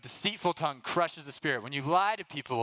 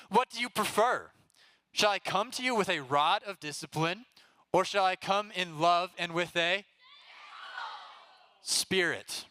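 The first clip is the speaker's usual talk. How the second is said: raised, higher challenge as Paul; voicing Paul; 5 semitones higher than usual. Paul challenging the church, raised voice.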